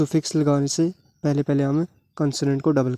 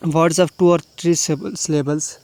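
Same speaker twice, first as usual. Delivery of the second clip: very slow, deliberate dictation of the rule; rule read aloud; much slower than usual. Shown as a demonstration. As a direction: spell the rule out word by word for students. Reads English rule aloud. (बहुत धीरे, शब्द-शब्द पढ़ना)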